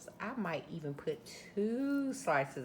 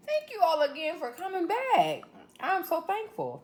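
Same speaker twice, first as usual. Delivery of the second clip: excited thanks; 11 semitones higher, 5 dB louder than usual. Real, not acted. Thanking viewers at the end.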